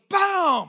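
a loud, high shout of boom; mock impact boom. Pastor reliving a hit in the water, loud boom. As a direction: bark out the boom like a blow landing. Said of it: "someone's hand goes".

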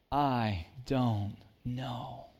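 calm honest answer. Shown as a demonstration.

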